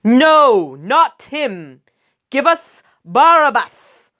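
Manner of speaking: loud, high demanding yell; a crowd yelling for Barabbas; crowd shouting back. Acted. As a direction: bellow it as an angry mob. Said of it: "They shouted back".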